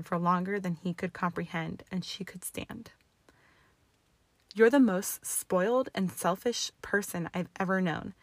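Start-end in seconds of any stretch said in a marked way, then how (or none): none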